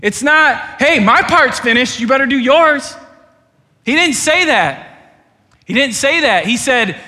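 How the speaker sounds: loud, high preaching voice; full-force preaching. Acted. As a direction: preach it hard to the whole room.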